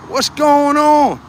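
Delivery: high, slow, drawn-out call; excited greeting. Real, not acted. Streamer hails his New Zealand viewers with an excited, drawn-out call. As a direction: stretch out "on" like calling across a room.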